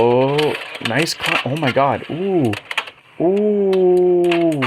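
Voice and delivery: rising excited oohs, loud; thrilled gasps and oohs. Real, not acted. Watching an amazing combo live.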